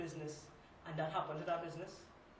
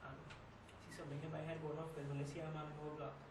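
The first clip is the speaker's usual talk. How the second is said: hushed recounting; 2 semitones lower, 7 dB quieter than usual. Acted. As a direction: recount the hold-up softly, with halting pauses. Recounting a frightening hold-up in monologue.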